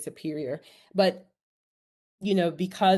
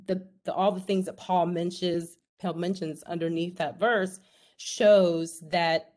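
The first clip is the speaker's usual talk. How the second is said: ordinary talk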